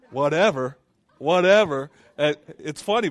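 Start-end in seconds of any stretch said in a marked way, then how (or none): 0.1-1.9 loud, dismissive whatever; sassy whatever. Retold or imitated. Preacher mocking his own young prayers, sassy and loud. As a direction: brush it off with attitude. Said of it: "I was kinda talking like that".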